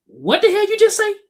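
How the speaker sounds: an indignant dad caught off guard; retold angry dad. Dad hearing his son cuss, mock outraged demand. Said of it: "And my dad said".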